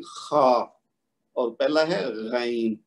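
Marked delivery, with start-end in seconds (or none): 0.0-0.6 خا with a gargling, rasping throat sound; خرخراہٹ والا خا; rasping kha. Shown as a demonstration. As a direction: scrape خا from the top of the throat. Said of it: "اس کی آواز تھوڑی خرخرے والی ہوتی ہے".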